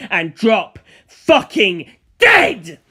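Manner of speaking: very slow, loud, drawn-out snarl; furious curse. Acted. Villain cursing his enemy to drop dead, slow and loud. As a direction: drag every word out as a loud threat. Villain hurling insults at Dio.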